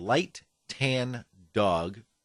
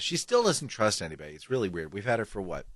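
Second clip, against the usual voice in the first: ordinary talk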